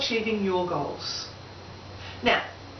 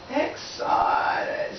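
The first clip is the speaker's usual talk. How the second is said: breathy whispered "Excited", almost no voice; whispered echo; 2 semitones higher than usual. Shown as a demonstration. Speaker to audience, whispering "Excited" as a hushed echo. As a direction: hush the word "Excited" like a secret.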